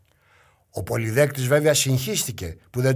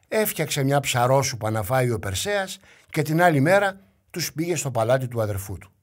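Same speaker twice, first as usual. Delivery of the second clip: ordinary delivery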